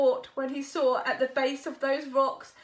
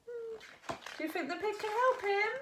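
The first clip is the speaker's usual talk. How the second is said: usual speaking voice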